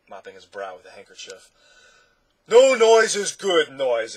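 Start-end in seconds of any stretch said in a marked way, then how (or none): 2.5-4.2 loud, high, drawn-out cheer; happy exclamation. A narrator's reading. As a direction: exclaim it happily, joining the joke. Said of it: "happily trying to catch the spirit".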